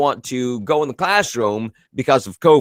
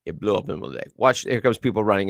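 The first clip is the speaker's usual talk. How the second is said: usual speaking voice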